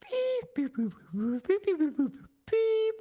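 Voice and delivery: very high electronic beeps and boops. Acted. Caster voicing the robot in their head, imitated droid beeps. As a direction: chirp like a little droid, high and playful. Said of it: "Everything sounds like R two D two".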